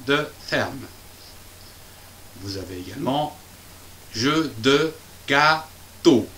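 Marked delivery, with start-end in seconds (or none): 4.1-6.3 jeu de gâteau drawn out slowly, each syllable apart; slow teaching read. Shown as a demonstration. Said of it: "G, A, accent circonflexe".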